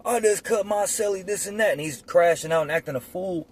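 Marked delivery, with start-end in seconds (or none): 0.0-1.7 mock yell to guard. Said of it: "he starts yelling to the C O".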